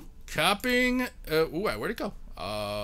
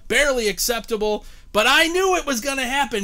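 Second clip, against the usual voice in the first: usual speaking voice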